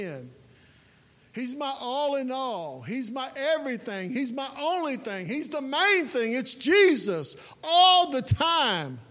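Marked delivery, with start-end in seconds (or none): 1.3-9.0 rising from firm to a full cry-shout, higher and louder; swelling from proclaiming to shouting; building to a shout. Real, not acted. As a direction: preach it up to a crying shout. Preacher builds to climax.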